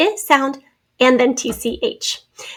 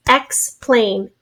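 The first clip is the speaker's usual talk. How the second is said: in syllables. Shown as a demonstration. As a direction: Explain split into EX, pause, PLAIN. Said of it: "two syllables".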